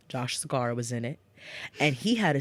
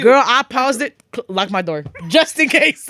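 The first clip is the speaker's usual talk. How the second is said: high, loud, emphatic excitement; worked up and loud; hyped-up retelling; 8 semitones higher, 8 dB louder than usual. Retold or imitated. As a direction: tell your girlfriend with big dramatic urgency. Hyped retelling of pausing the show.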